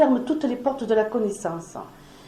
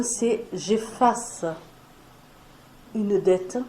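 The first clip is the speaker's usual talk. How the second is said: slow and deliberate; 2 semitones lower, much slower than usual. Shown as a demonstration. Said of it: "Le vrai pardon".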